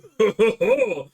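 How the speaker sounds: loud burst of laughter; cackling at his own joke. Real, not acted.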